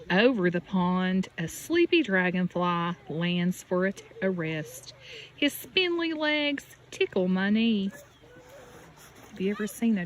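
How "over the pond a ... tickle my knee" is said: gentle storybook read with a lift on each refrain; reading to children. Acted. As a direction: read it like a bedtime picture book for a little one. Reading picture book to child.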